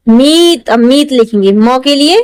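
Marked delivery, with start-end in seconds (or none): none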